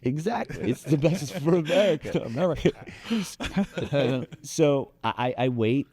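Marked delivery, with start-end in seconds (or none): 0.0-2.3 mock official voice. Echoing cohost in mock voice.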